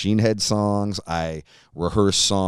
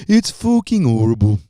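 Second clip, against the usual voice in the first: Birmingham accent; 6 dB louder than usual. Shown as a demonstration. Host reviewing vegan Bailey's, in a put-on Birmingham accent. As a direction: do your best Brummie accent, loudly. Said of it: "That's my best Birmingham, England accent".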